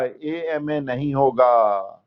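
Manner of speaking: teacher showing the wrong article slowly; slow wrong example. Shown as a demonstration. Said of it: "he is an एम ए होगा".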